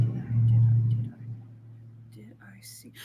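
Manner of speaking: breathy whispered stalling, slowing down; stalling in a teasing whisper. Real, not acted. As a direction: hush it like a secret. Playful echo of the question.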